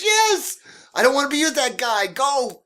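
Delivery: very high, loud and fast; insist, frantic to leave; urgent insistence. Retold or imitated. Woman insisting the driver leave without her boyfriend.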